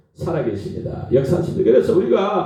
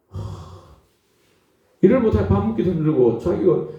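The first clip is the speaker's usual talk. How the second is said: ordinary talk, nothing marked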